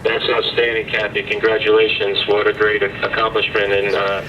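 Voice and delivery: tinny radio congratulations; astronaut radio. Real, not acted. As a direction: sound like a radio call from orbit.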